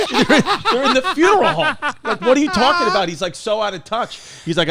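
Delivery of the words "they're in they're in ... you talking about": high, louder words breaking into laughter; laughing disbelief. Real, not acted. Mocking the funeral director's claim, laughing higher. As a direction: laugh through it, you can't believe him.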